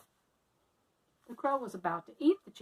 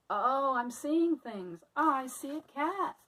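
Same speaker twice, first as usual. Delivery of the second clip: slow, higher, louder fearful gasp; scared disbelief; 5 semitones higher, 5 dB louder, slower than usual. Acted. Annie spotting the cat, slow and frightened. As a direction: stare in horror at a cat.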